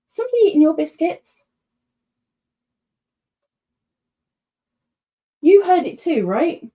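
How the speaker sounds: high, loud alarmed question to the dog; spooked, asking if the dog heard it; startled alarm. Real, not acted. Streamer asks her dog about a noise, high and alarmed.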